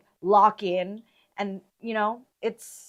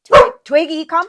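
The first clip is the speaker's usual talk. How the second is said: calling out; 8 semitones higher, 14 dB louder than usual. Real, not acted. Calling the dog over.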